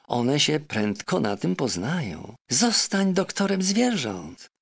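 a clever parrot lecturing her master. Acted. Parrot Polynesia urging the doctor, high and insistent.

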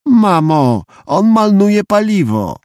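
put-on high, slow whine of a little boy; tattling child. A narrator's reading. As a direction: whine to mum about your brother, drawing it out. Child complains to mum about brother. (wysokie, przeciągłe skarżenie dziecka)

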